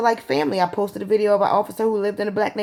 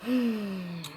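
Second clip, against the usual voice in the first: heavy sigh; 3 semitones higher, 8 dB quieter than usual. Real, not acted. Weary pause before whispering.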